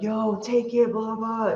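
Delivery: high mock crowd scream, held back in volume; imitated screaming. Crowd screaming for the performer to take the jacket, mock scream. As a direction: mimic a crowd calling out, high-pitched. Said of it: "Everybody starts screaming".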